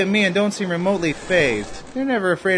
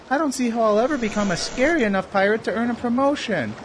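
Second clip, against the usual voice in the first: ordinary talk, nothing marked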